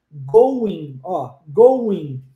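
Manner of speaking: "going" stopping on the N, go-in, low and firm; silent final G. Shown as a demonstration. Teacher showing students the mute letter, "going" stopping at N. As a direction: end "going" on N without the G. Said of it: "Você para no N".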